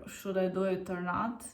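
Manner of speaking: ordinary delivery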